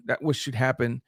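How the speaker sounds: normal talking voice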